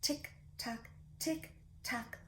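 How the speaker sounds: soft unvoiced ticking, clock-like; whispered tick tock. Shown as a demonstration. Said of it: "Can you do that with me?".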